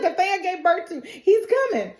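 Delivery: high-pitched mock panic; acted alarm. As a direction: warn in a frightened high voice. Acting out Fatima's alarm.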